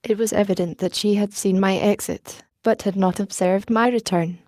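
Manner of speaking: ordinary delivery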